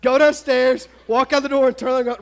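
loud, urgent run-through of the orders; emphatic obvious answer. Acted. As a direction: punch out each step as the obvious thing to do.

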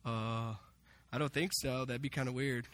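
low, quiet, unsure mumble; acting out a sheepish crowd, soft and low; hesitant reply. Crowd hesitantly answering Jesus' question.

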